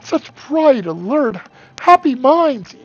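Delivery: high, slow, grandly swelling professor. Acted. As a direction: proclaim it like a proud old professor. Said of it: "Professor Fanshawe says".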